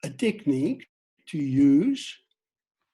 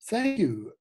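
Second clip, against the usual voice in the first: demo reply; 5 semitones higher than usual. Shown as a demonstration.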